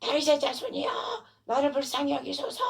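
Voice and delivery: high, pleading call; imitated crying out. Beggar pleading over the scolding crowd, crying out. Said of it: "그가 더욱 심히 소리 질러".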